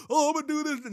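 high, mock-eager voice of a self-deceived believer; hammed-up sudden inspiration; mock dreamy resolve. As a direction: lampoon the pizza-dream calling. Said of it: "you had a dream and".

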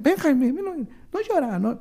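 high, slow soothing to a crying friend; comforting. Retold or imitated. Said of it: "ya me abrazó Claudia".

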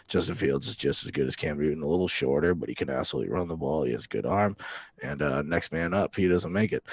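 low-pitched mock coach press conference; Bill Belichick. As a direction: drop deep into a deadpan football coach act. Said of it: "we got Bill on the phone".

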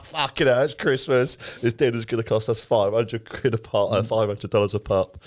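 comic outraged dread; retold panic. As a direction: relive the bill shock, hammed up. Retells dread at the dinner bill.

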